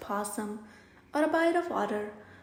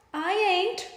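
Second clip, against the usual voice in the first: snooty refusal; 6 semitones higher, 4 dB louder than usual. Acted. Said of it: "snouts Mademoiselle Possum".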